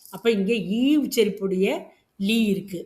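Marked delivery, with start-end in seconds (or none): none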